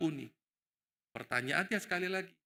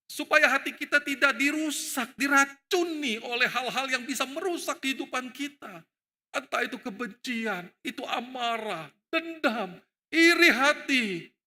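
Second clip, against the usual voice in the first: higher, louder preaching build; fiery preaching; 7 semitones higher, 4 dB louder than usual. Acted. Preacher warns the congregation, rising and forceful. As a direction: drive the warning home to the congregation.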